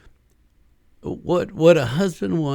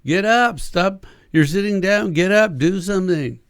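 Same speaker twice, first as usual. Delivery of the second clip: louder, higher barking orders; mock harsh scolding; 5 semitones higher, 5 dB louder than usual. Husband scolding a wife stuck in the pit, loud and harsh. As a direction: scold the spouse to get up.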